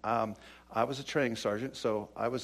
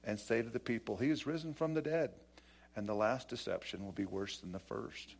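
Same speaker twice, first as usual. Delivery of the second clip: quiet, measured reading of the passage; scripture reading; 4 semitones lower, 12 dB quieter than usual. Acted. Pastor reads the Gospel passage to the congregation, hushed. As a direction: read the verses softly and evenly.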